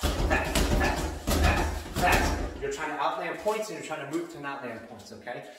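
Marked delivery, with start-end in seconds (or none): 0.0-2.4 loud, breathy bursts on every fast; sharp barked counts. Shown as a demonstration. Coach calling the fast pace of ring strikes, loud breathy bursts. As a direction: spit each fast out like a punch, louder. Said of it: "Everything's fast".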